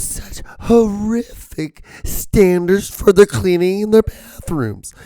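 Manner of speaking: high, loud, slow and scandalized reviewer voice; snooty Yelp reviewer. Retold or imitated. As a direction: clutch your pearls over the dirty bathrooms. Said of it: "I don't wanna sound like this on the computer".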